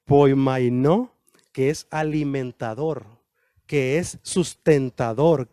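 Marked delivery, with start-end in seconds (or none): 0.0-1.1 Poimainó said slowly, rising at the end; careful Greek pronunciation; Greek word poimainó. Shown as a demonstration. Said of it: "No sé si lo pronuncio bien".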